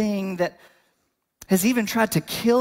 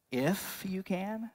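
slow, quiet disbelieving "If you can?" with a rising end; incredulous question; 7 dB quieter, slower than usual. Retold or imitated. Jesus questioning the father's doubt, quiet and incredulous. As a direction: echo his doubt back softly, drawn out. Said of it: "I just imagine Jesus is looking around like".

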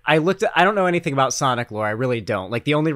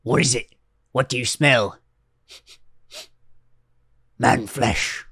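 quiet orc voice; 2 semitones lower than usual. Retold or imitated. Mocking a terf walking into a bathroom, a lightly voiced orc. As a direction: mutter it like a suspicious orc. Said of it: "Average terf when they walk into a bathroom".

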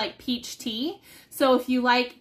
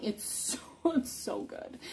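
soft whispered rave; 3 semitones lower, 11 dB quieter than usual. Real, not acted. Savoring the taste.

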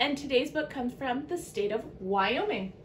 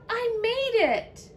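high, drawn-out cry of victory; triumphant cheer; 10 semitones higher, slower than usual. A narrator's reading. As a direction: stretch it out in triumph. Snail celebrates arriving.

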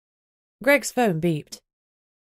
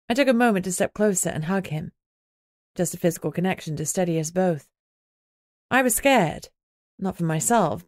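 ordinary delivery

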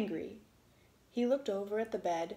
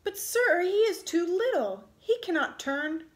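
much higher, loud protest; shouted plea; 7 semitones higher, 6 dB louder than usual. A narrator's reading. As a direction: cry out for his little brother.

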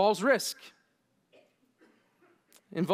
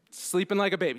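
ordinary delivery

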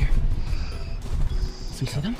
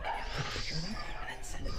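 slow whisper; 4 semitones lower, 12 dB quieter, much slower than usual. Real, not acted. Hiding, whispered plan.

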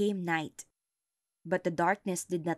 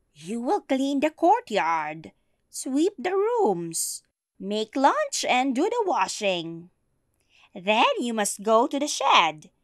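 threatening witch; 5 semitones higher, 8 dB louder than usual. Acted.

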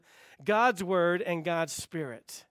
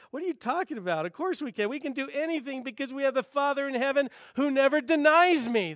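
incredulous Jesus; 7 semitones higher than usual. Retold or imitated. Pastor voicing Jesus, incredulous at the father's doubt. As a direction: let the disbelief lift and swell. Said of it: "he's incredulous when he hears".

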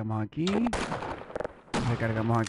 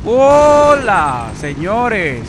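much higher, louder mocking greeting; triumphant shout; 8 semitones higher, 14 dB louder, much slower than usual. Real, not acted. Gamer greeting enemy soldiers with a gleeful shout. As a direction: hail the soldiers like party hosts.

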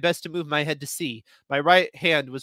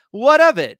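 sharp retort; 10 semitones higher, 11 dB louder than usual. Acted. Snappish reply in quarrel.

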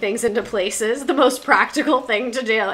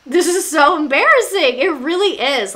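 louder, higher cry of disbelief; exasperated outburst; 5 semitones higher, 5 dB louder than usual. Real, not acted. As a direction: throw up your hands at the absurdity. Mocking cringe at official.

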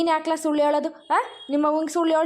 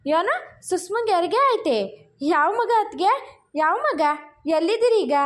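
higher-pitched, eager questions; excited surprise; 3 semitones higher than usual. Acted. Brother-in-law hearing of a birth by phone, excited surprise. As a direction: react with delighted surprise to big news.

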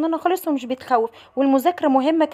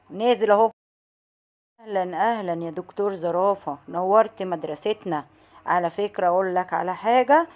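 low-pitched, unhurried tiger voice; calm little tiger; 6 semitones lower than usual. Acted. Tiger welcomes the giraffe doctor, calmly brave.

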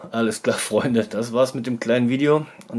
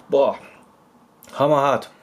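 loud gasp at the chili heat; mouth burning from spicy food; 4 dB louder than usual. Real, not acted. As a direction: blurt it out, mouth on fire. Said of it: "die geht direkt voll ab".

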